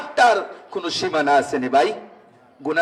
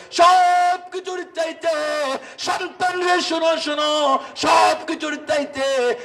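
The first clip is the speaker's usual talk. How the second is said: high, urgent-sounding chanted call; 8 semitones higher than usual. Acted. Father tells his child to listen, high calling out.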